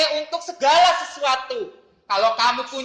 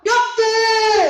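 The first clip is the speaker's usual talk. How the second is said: put-on child answering; 10 semitones higher, 8 dB louder than usual.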